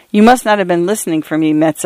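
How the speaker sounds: ordinary delivery, nothing marked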